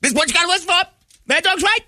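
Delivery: shrieky, very high-pitched host impression; Mad Dog. Radio host mad-dogging away from his high tent, piercing impression. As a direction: rant as if screaming over a crowd, piercingly high. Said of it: "he is just at top of his lungs".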